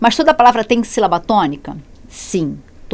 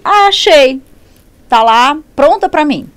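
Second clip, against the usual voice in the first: high, louder mock discovery; triumphant find; 5 semitones higher, 5 dB louder, slower than usual. Student finding the word ready in a dictionary, mock triumphant. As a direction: exclaim as if you just found it.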